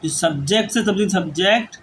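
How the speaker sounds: word-by-word dictation with long pauses; slow dictation. Acted. Teacher dictating a grammar rule to students, slow dictation. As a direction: dictate slowly, giving time to write.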